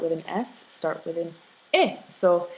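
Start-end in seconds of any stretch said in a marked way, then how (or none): 1.7-2.0 "E" said as a short open "eh"; eh sound. Shown as a demonstration. Teacher naming the sound Spanish words start with, "E" as eh. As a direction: pronounce "E" as eh, not as the letter name. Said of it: "that eh sound that wants to jump in".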